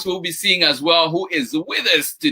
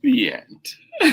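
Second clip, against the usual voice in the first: hushed story ending; 9 semitones higher than usual. A narrator's reading. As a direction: close the book with a near whisper. Closes the story.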